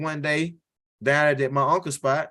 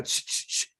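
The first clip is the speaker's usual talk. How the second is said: hog rustle; 8 dB quieter than usual. Retold or imitated. You hear.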